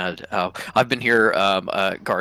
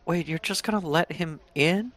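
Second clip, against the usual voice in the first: high, softer, disbelieving guard voice; a startled guard, pitched way up and hushed; incredulous guard; 8 semitones higher, 7 dB quieter than usual. Acted. Second guard protests to his partner, high and disbelieving. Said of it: "cleric guard is like".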